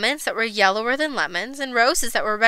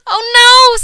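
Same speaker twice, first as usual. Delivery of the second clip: high, loud wail; dismayed mother; 11 semitones higher, 10 dB louder than usual. A narrator's reading. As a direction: cry out in alarm. Mother discovers colored child.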